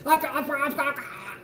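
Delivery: high, mock halting ahs; imitating stroke stutter. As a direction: mimic a stuttering old man mid-stroke. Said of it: "had that fucking stroke at the end where he was like".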